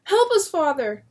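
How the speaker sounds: high loud desperate plea; pleading cry. Acted. Daughter begging her father for help, desperate cry. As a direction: beg father to save you.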